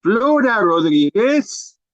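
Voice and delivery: high, stretched-out calling voice; como llamando. Acted. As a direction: call out for a missing passenger, rising at the end. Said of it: "Como llamando". (llamando en tono agudo y cantado)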